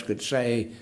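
usual speaking voice

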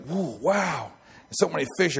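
high, breathy-free amazed gasp of joy; wide-eyed fishermen thrilled by the haul; excited whoa. Acted. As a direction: marvel at the catch. Excited fishermen voiced in sermon.

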